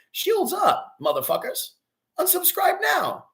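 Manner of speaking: high-pitched dismissive cry; defiant outburst. Real, not acted. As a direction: send the haters off, voice pitched high. Defiantly dismissing hostile viewers.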